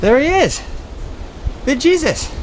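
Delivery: high-pitched, drawn-up cry of arrival; excited reveal. Real, not acted. Traveler finally sighting Big Jesus, high and excited. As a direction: hail it in a high, thrilled voice. Finally sees the giant statue.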